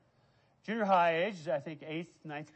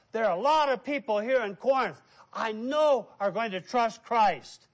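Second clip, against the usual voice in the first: fervent voice of God; 5 semitones higher, 7 dB louder than usual. Acted. God's voice promising Paul.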